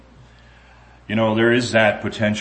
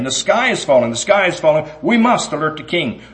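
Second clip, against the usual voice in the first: high, slightly louder frantic hen voice; panicky hen; 8 semitones higher, 4 dB louder than usual. Acted. Henny Penny warns Goosey Brucey, high and frantic. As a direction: cry the alarm up high.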